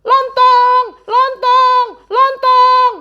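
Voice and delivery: high loud panicked cries; mock panicked shout; frantic yelling. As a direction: yell as if in panic. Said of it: "Mereka berteriak-teriak".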